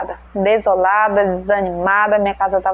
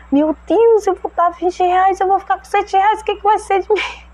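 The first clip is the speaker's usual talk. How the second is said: much higher, rising into a thin panicky pitch; panicked protest; 8 semitones higher than usual. Retold or imitated. Woman protests to the Holy Spirit about money, high panicky plea. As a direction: plead in mock alarm, voice climbing.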